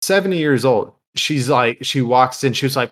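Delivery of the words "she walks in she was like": ordinary talk, nothing marked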